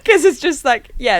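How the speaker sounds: amused, words bubbling with laughter; laughing. Real, not acted. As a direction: laugh it out while explaining. Laughing at Aussie shop greetings.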